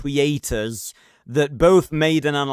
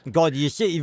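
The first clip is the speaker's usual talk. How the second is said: ordinary talk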